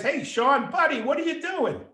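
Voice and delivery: high, excited call to a buddy; imitated friendly hail. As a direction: hail a pal with pitch soaring. Valet hails a familiar friend.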